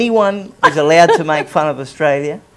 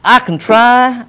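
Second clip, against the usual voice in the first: slow, raised Texas drawl; Texas accent; 5 semitones higher, slower than usual. Shown as a demonstration. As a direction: draw it out in a put-on Texas accent. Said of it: "Can you do your Texas accent".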